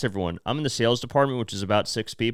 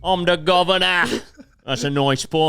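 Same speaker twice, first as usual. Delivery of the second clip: exaggerated British impression; mock British accent; 5 semitones higher than usual. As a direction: belt out a hammy British catchphrase. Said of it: "It's this British guy".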